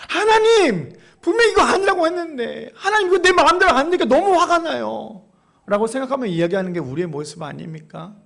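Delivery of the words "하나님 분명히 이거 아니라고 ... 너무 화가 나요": high, pleading protest; frustrated complaint. Acted. Preacher voices a believer protesting to God, frustrated complaint. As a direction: burst out in mock exasperation, pitched up.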